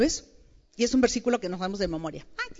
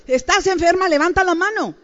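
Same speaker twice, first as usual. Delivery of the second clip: loud, high call to the room; 7 semitones higher, 10 dB louder than usual. Real, not acted. Preacher calling out to the sick women, loud and high. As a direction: rouse the crowd to raise hands.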